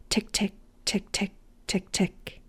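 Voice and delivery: soft clicking tick of windshield wipers. Acted. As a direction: tick it out softly like wipers on dry glass. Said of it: "great sound effects".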